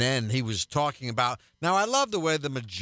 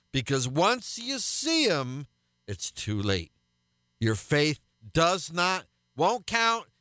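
rising, higher-pitched pulpit warning; fervent preaching; 8 semitones higher than usual. Acted. Radio pastor urging listeners to repent before it's too late, preaching high and insistent. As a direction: hammer home that it will be too late.

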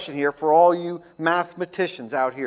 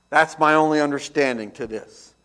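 usual speaking voice

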